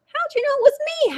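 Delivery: hammed-up howl of a found-out turkey; mock howl. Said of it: "howled Turkey".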